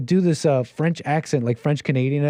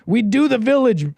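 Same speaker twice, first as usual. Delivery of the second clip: high, louder punch on the catchphrase; mock rallying cry; 8 semitones higher, 4 dB louder than usual. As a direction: proclaim it like a crew battle cry. Repeats his kitchen rallying cry.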